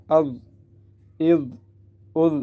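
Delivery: heavy dh, tongue tip on teeth; full-mouthed zaa. Shown as a demonstration. Teacher explains thick letter, making the dh heavy. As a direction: thicken the closing dh. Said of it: "پر کرتے ہوئے".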